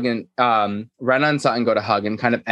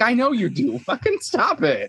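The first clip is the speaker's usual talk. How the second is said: high-pitched playful telling-off; mock scolding; 12 semitones higher, slower than usual. Imitating a castmate telling off her friend, high mock scolding. Acting out Garcelle scolding Erica.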